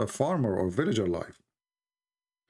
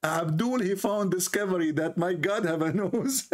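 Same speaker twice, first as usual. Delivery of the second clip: laughing through the words, pitched up; can barely get it out for laughing; real giggling mockery; 9 semitones higher than usual. Real, not acted. Mocking Abdul's discovery.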